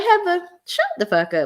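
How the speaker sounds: high, put-on sassy voice; mock dismissive retort. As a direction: cut her off in a high mocking tone. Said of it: "I'm like".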